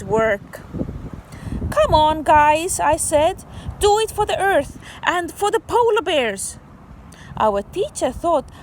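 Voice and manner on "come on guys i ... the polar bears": higher and louder, cheering the class on; rally the kids like a little coach; mock rallying shout. Child urging classmates to keep digging, excited shout.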